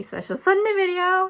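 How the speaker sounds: very high, loud sing-song; high-pitched, giddy excitement; excited announcement. Real, not acted. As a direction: squeal it out like a big reveal. Announcing her special upcoming video.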